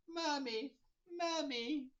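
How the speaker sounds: high whimpering mommy; hammed-up crybaby whine; mock baby crying. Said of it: "Batman's crying like a little baby".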